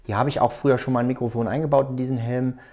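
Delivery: normal talking voice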